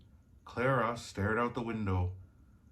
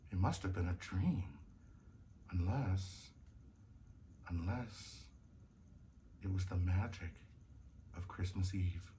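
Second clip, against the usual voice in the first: low, very soft, with long pauses; hushed wondering; 4 semitones lower, 10 dB quieter than usual. A narrator's reading. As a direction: muse quietly, letting the mystery linger. Clara wonders if she dreamed.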